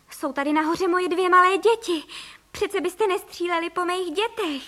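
high-pitched, urgent appeal; desperate plea. A narrator's reading. Mother pleading for her two small children, desperate plea. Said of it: "vykřikla paní Frejková".

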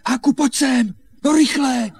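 urgent calling. Acted. Boy summoning his friend during the farm search, urgent calling. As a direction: call the friend over in a hurry. Calls friend to come quickly.

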